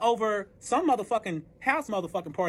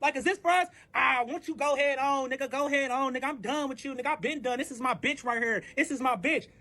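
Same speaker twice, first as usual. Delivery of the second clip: retold confrontation; 5 semitones higher than usual. Acted confrontation on catching them together.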